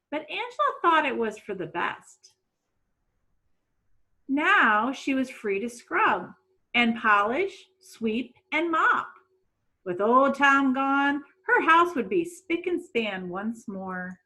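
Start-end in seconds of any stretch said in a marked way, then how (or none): none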